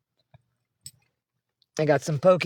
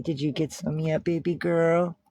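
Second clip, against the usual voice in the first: ordinary talk, nothing marked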